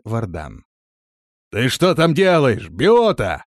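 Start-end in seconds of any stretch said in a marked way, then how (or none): 1.5-3.4 loud call down a well; shouted question. A narrator's reading. Man calling to Biota in the well, shouted question. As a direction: shout it down to someone below. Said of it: "крикнул он".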